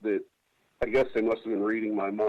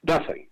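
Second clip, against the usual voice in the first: "Nothing" jumped much higher, alone and falling; emphatic nothing; 8 semitones higher than usual. Real, not acted. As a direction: fling out "Nothing" high in disbelief. Exasperated repeat after they did nothing.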